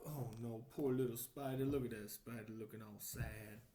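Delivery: ordinary delivery, nothing marked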